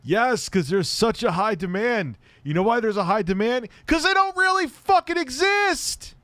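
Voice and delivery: high, loud sarcastic rant; mock the demand sarcastically. Real, not acted.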